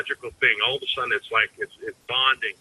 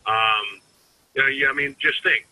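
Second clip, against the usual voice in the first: ordinary delivery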